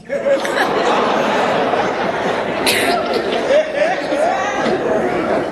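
breathy high-pitched laughter; real helpless laughter; long high laugh. Real, not acted. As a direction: laugh hard at the memory. Laughing at own story.

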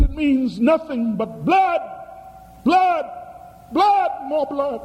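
high, rising, drawn-out doom; ominous prophecy. Retold or imitated. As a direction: build the dread on each blood. Prophetic warning retold.

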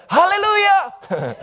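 high, drawn-out exultant cry; old father's joyful outburst; loud yell. Retold or imitated. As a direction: yell it out slowly in sudden praise. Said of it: "yells with a loud voice again".

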